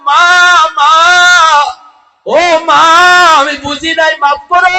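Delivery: high, loud wail of a pleading son; imitated crying plea. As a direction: cry out for mother, dragging the words out. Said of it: "যুবক কান্দে আর কয়". (কান্নার ভঙ্গিতে চিৎকার করে আকুতি)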